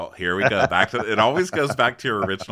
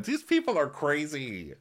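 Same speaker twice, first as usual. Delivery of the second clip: ordinary talk, nothing marked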